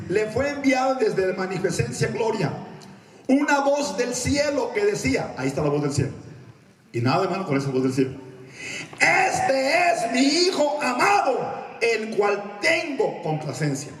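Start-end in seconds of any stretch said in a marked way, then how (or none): 0.1-5.3 high, slow, declaimed reading; scripture proclamation. Acted. Preacher reads Peter to congregation, high declamation. 9.0-13.9 high, very slow proclamation; God's voice from heaven. Acted. God presenting his son, high slow proclamation.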